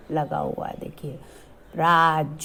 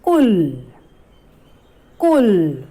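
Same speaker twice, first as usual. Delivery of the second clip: louder, each कुल called out; clear drilled word; 6 dB louder, much slower than usual. Shown as a demonstration. Teacher modelling a word for children, loud. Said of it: "क पर छोटे ऊ की मात्रा".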